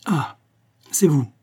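surprise franche, voix plus haute; « Ah » étonné, aigu, sans inquiétude; surprised. A narrator's reading. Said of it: "sur un ton assurément de surprise". (le capitaine ouvre la porte et découvre Reynaud, surpris)

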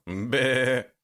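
bleating like a goat; a short goat bleat. Acted. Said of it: "Коза отвечала".

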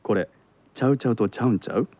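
fast, understated Kansai question; Kansai dialect. Shown as a demonstration. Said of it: "これが関西弁では".

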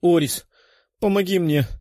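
high, desperate begging; pleading. A narrator's reading. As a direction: beg for help, wounded. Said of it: "молил один из раненых".

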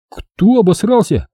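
high, drawn-out indignation; hurt young soldier protesting slowly; offended. A narrator's reading. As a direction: bristle at the insult, pitch up. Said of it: "обиженно вскидывается пацан".

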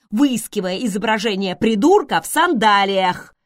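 loud, exasperated rasp; croaked exclamation with force; hoarse outburst. A narrator's reading. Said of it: "прохрипела Селия".

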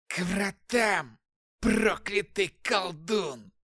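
high, slow hoarse demon whisper; demonic whisper. Acted. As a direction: barely breathe it out, slowly. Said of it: "еле слышно прошептал допрашиваемый".

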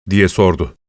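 ordinary delivery

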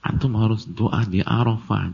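ordinary talk

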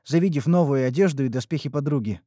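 ordinary delivery, nothing marked

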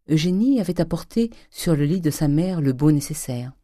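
plain reading voice

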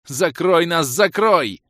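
high, loud urgent plea; panicked shout. A narrator's reading. Mage begs the fighter for cover, panicked shouting. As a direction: yell it frantically, pitched high.

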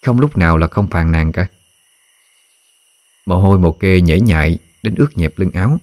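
ordinary delivery, nothing marked

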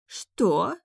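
aghast queen; shocked gasp. A narrator's reading. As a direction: gasp it out in disbelief. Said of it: "ахнула Патрисия".

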